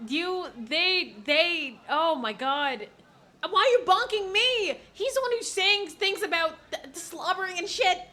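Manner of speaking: loud, high, outraged protest; shouting at the characters on screen; outraged yelling. Real, not acted. Streamer yelling at the teasing characters, outraged and high.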